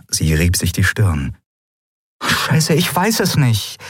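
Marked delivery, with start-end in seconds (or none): 2.2-3.8 higher-pitched, exasperated admission; frustrated. A narrator's reading. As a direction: blurt it out, vexed at yourself. She is frustrated at her doubt.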